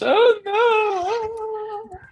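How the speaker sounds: high, loud mock wail; wailing child. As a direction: cry it out like a distraught kid, drawn out. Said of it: "All I hear is her out there in the living room".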